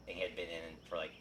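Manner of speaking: ordinary talk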